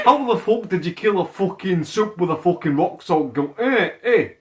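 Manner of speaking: hammed-up English accent, badly faked; attempted English accent. Friend as Butcher questioning the rock-salt kill, mock English accent. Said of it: "I don't even know what an English accent is".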